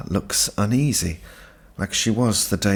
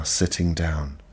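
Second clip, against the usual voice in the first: usual speaking voice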